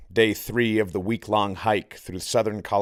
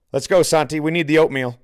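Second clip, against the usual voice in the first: raised, higher voice calling to the tent; 7 semitones higher, 6 dB louder, faster than usual. Acted. Trip leader calls into the tent to hurry Santi, raised voice. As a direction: call him out of the tent, loud and high.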